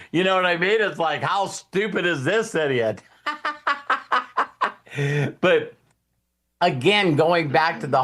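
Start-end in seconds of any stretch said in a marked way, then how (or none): 3.3-4.7 high laugh. Real, not acted. Laughs at his own joke.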